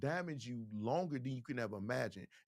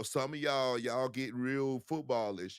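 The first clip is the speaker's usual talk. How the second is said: normal talking voice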